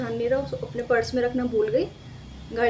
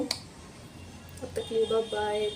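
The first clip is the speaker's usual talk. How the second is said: slow, softer, stretched-out bye bye; lingering slow farewell, softer; slow drawn-out goodbye; 2 semitones lower, 7 dB quieter, much slower than usual. Shown as a demonstration. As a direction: drag out the goodbye lazily.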